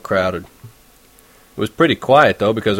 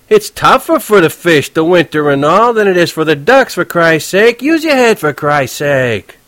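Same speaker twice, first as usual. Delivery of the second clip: touchy cabbie; 7 semitones higher, 4 dB louder than usual. Acted. Horwitz explaining fish to Holden, irritated. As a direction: lecture the kid, exasperated.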